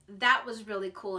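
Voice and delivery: normal talking voice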